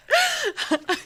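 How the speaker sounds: squealing, helpless laugh; real high-pitched giggle; high giggling. Real, not acted. Cracking up.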